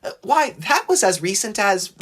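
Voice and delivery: high-pitched, indignant piping voice; high little engine objecting. A narrator's reading. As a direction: pipe up with a high protest. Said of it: "Percy piped up".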